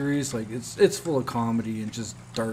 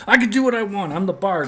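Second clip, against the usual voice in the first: higher and louder, mock-defiant; a cocky hero arguing back; defiant bard; 7 semitones higher, 6 dB louder than usual. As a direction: bard arguing with the game narrator. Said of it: "And he's like".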